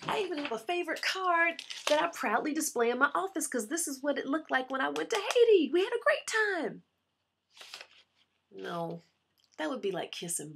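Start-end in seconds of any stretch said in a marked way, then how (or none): 0.1-6.8 higher and louder, gushing with delight; rising into a gush about the trip. Acted. Bragging about Haiti to impress him, gushing higher and louder.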